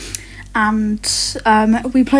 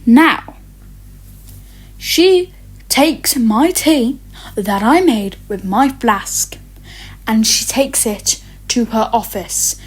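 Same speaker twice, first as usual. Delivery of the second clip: normal talking voice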